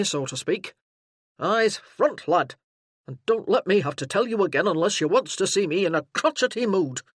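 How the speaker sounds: a burly sergeant taking charge; booming soldier arriving in force; gruff sergeant. Acted. Sergeant explains and drills.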